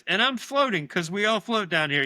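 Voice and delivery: high, loud gleeful ghoul voice; creepy high voice. Acted. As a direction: taunt them like a grinning dead boy. Severed head taunting from fridge.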